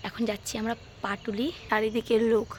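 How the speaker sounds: hushed, soft voice; speaking softly. Real, not acted. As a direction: keep it down outside a house. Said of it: "ভাবছো কেন আস্তে আস্তে কথা বলছি?".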